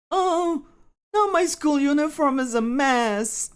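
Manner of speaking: very high-pitched complaint; high cartoonish dismay; whining anime schoolkid. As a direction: whine it high over the mess. Pending's line in a put-on voice.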